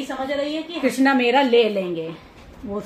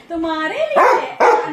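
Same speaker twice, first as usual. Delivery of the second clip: laughing, higher and louder, slow; laughing reassurance; 4 semitones higher, 9 dB louder, slower than usual. Real, not acted. Mother laughing to her daughter, slow reassurance.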